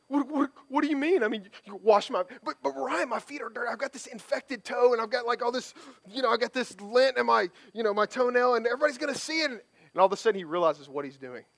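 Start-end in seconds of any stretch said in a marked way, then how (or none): 0.1-9.5 higher, flustered panicky rush, imitating a friend; panic mode. Friend protesting to the youth pastor in mock panic, pitched higher. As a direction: play the friend who's scared everyone will see his feet. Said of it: "he goes into panic mode".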